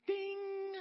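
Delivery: high, loud imitated bell ding; a bright ding of sudden insight. Realization moment, bell sound.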